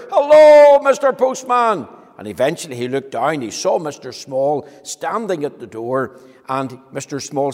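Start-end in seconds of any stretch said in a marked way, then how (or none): 0.1-1.9 high, very loud call; straining to be heard from far below; louder shout. Acted. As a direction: yell it so he finally hears. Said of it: "so he had to shout louder".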